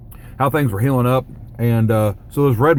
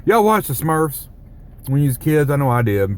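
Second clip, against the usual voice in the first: usual speaking voice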